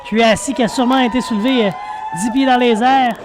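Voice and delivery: lively play-by-play call; wrestling commentator. Real, not acted. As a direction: call the match live as it unfolds. (commentateur de catch)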